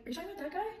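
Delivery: high, softish disbelief; incredulous question. Retold or imitated. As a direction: re-enact asking in astonishment. Disbelieving question about the threat.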